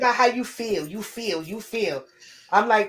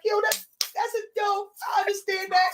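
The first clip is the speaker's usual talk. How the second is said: choked-up gush; 13 semitones higher than usual. Retold or imitated. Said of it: "I was like".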